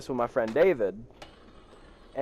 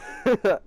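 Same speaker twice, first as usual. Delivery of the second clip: short high giggle; a real little burst of laughter; amused laugh; 8 semitones higher, 4 dB louder than usual. Real, not acted. Laughing at the audacity of this woman, real giggle.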